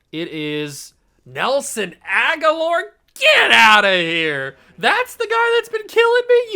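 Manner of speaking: slow, then a loud, high outburst; can't believe who's been beating you; stunned disbelief. Real, not acted. Disbelief at rival player's name.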